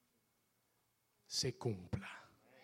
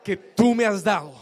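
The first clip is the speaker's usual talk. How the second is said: shouted declaration of faith; 3 semitones higher, 12 dB louder than usual. Shown as a demonstration. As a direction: belt it out, much louder.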